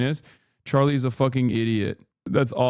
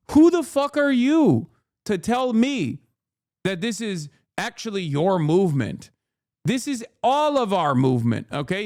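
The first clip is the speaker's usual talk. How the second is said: furious challenge; 5 semitones higher than usual. Real, not acted. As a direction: lean into each word in outrage. Ranting at a critic's post.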